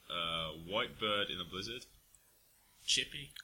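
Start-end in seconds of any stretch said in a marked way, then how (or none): 2.8-3.3 a quick South African accent on Chippy. Shown as a demonstration. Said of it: "That was me attempting to do a, uh, South African accent there".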